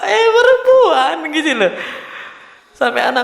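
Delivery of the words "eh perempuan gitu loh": high-pitched, talking through laughter; real chuckle carried through the remark; amused laughing. Real, not acted. Preacher remarks on how women are, still laughing.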